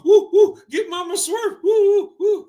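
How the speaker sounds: high-pitched hooting imitation, loud on the woos; mock excitement. Reactor replaying what the father said, mock excited hooting. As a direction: whoop it up to mock his excitement. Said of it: "He's getting excited".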